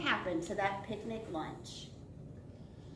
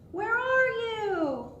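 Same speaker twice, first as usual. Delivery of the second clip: high, loud, drawn-out search call; long sing-out storybook holler; 11 semitones higher, 6 dB louder, much slower than usual. Acted. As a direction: call out for a missing pet. Boy searching for dog and bear.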